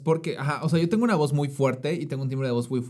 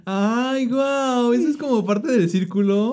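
squealing in delight, much higher and louder; high excited squeal; 12 semitones higher, 8 dB louder, much slower than usual. Real, not acted. Friend squeals with delight at the news. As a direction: gush over it at the top of the voice. Excited reaction to joining the circle.

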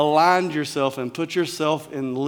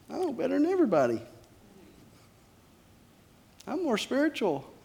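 much higher, fast, smug sing-song; mocking smugness; 10 semitones higher, 5 dB quieter, faster than usual. Preacher mocking a proud believer, in a high smug voice. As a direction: lampoon a know-it-all's pride.

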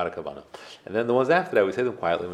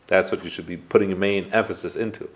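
normal talking voice

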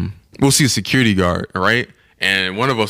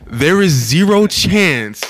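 retold disbelief; 6 semitones higher, slower than usual. Friend retelling the front-desk man's gesture, incredulous. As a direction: stretch each word in exaggerated disbelief.

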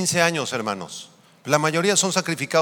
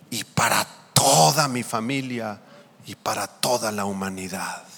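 fervent led prayer; slower than usual. Acted. Preacher has congregation raise hands and pray after him, fervent and slow.

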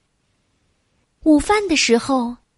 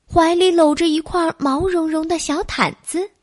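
usual speaking voice

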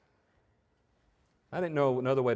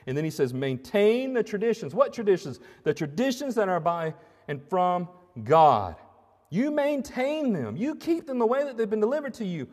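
higher, louder preaching voice; full-force preaching; 8 semitones higher, 6 dB louder than usual. Acted. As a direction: drive the point home to the congregation.

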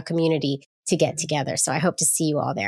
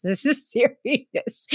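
laughing mock-serious quote; 5 semitones higher, much slower than usual. Laughs while quoting worried faces.

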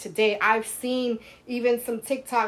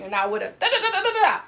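squeaky da-da-da chainsaw sound; high mock chainsaw noise; 9 semitones higher, 3 dB louder than usual. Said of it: "Just like Madea did".

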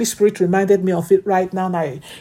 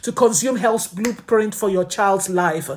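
ordinary delivery

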